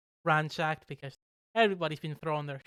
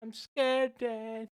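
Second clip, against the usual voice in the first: mock scared whine, pitched up; whiny Lofty plea; 5 semitones higher, much slower than usual. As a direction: whimper for Dan's help like a scared child. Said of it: "Lofty just turns to the side and goes".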